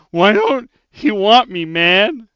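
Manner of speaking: loud, high hammed-up wail; mock bawling. Bawling at the desk to a coworker, mock crying. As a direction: wail it slightly too big, imitating a sobbing outburst. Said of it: "I'm literally bawling".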